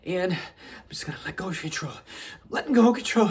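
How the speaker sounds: struggling to surrender. Acted. Man coaxing himself to surrender control, strained and hesitant. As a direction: talk yourself into letting go, pause by pause.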